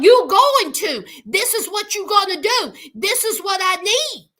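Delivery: loud, bossy commands; mock bossy daughter; bossy demand. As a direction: order her mother around. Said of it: "talking about".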